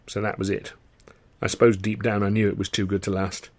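ordinary delivery

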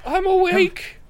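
very high little halfling voice; awakened halfling. Acted. Halfling Jorik tells screamers he's awake, high-pitched. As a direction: pipe up loudly in a high voice.

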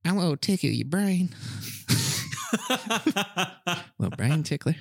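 high, creepy, giggling character; creepy brain tickler voice. Acted. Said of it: "I think that's what he would sound like".

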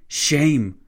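loud, high cry of condemnation; outraged yell. A narrator's reading. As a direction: yell it in his face. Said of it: "Clavel yelled".

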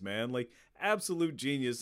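usual speaking voice

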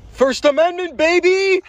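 high, loud crowing yell; triumphant shout. Real, not acted. Boasting to friends that it's legal, triumphant shout. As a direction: whoop it out, stretching the last word high.

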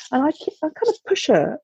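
usual speaking voice

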